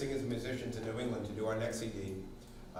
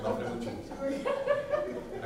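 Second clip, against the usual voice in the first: high, slow, stretched Mafuta Muchi; drawn-out name; much slower than usual. Pretend. Teacher savoring a kid's funny name, high and slow. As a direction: draw the name out high and long with a laugh.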